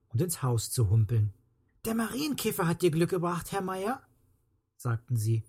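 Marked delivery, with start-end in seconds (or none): 1.8-4.0 put-on higher-pitched childlike voice; children's voices. A narrator's reading. Children telling the rescued neighbour about his luck, childlike. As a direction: speak up like a pleased child.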